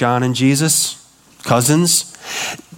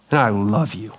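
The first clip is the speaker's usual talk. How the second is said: tender father; 4 semitones lower, 4 dB quieter than usual. Retold or imitated. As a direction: say it like holding a child close. Father speaking to his child.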